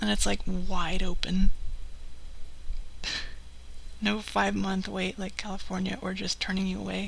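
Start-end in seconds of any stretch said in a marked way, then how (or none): 1.5-3.9 amused, unvoiced-breath laugh; real amused laugh. Real, not acted. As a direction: laugh at your own story. Amused by her own story.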